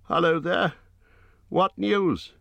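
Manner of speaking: high, trembling call; shaky voice. Acted. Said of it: "he called with a shaky voice".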